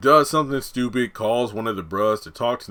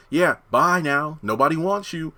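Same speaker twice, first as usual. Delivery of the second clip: teasing dismissal in a raised pitch; taunting; 6 semitones higher than usual. Retold or imitated. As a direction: imitate a taunt, drawing out the goodbye. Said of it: "taunting her".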